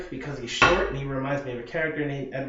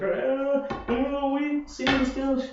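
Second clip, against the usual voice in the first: lightly voiced [name]; 11 semitones higher, slower than usual. [name] stepping up to punch.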